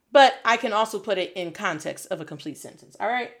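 normal talking voice

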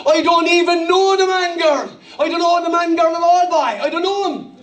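high, indignant Cork denial; Cork accent. Acted. Peter denying with an oath, high-pitched Cork accent. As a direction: protest in a put-on Cork voice. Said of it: "let's say Peter's from Cork".

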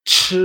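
CH with a loud burst of air; aspirated ch. Shown as a demonstration. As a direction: blow air out on CH. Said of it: "tengo que notar ese aire".

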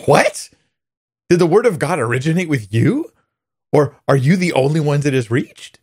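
high, indignant challenge to the quote; incredulous refutation. Retold or imitated. Imitating a teacher reading to her class, incredulous refutation. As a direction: throw it back as outraged questions. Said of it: "You see how it sounds like a refutation".

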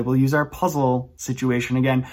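usual speaking voice

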